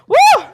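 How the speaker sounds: very high, loud "woo"; cheering whoop. Real, not acted. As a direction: burst out with a piercing-high whoop. Whoop while counting reps.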